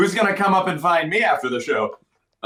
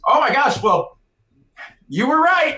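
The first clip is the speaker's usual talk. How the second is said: put-on drunk guy's realization; 10 semitones higher than usual. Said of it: "let go of me and goes".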